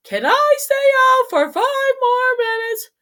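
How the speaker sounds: put-on high, slow whiny kid plea; pleading kid. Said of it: "I would give".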